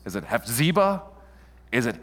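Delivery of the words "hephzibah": Hephzibah with a long stressed middle, hef-ZEE-buh; offered pronunciation. Shown as a demonstration. Preacher trying out readings with the congregation, Hephzibah stressed in the middle. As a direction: lean on the ZEE of Hephzibah, questioning. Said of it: "How would you pronounce it?".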